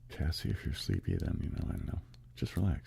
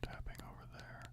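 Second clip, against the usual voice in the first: breathy ASMR whisper; 2 semitones higher, 6 dB quieter than usual. Shown as a demonstration. ASMR host whispering to stream viewers while tapping. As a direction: whisper close and gently over the tapping. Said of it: "I'll do a little bit of whispering".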